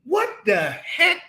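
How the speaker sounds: high, startled mock exclaim; baffled what the heck. Husband reacting to wife's outburst, high baffled exclaim. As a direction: squeak it out in disbelief. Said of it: "I'm going".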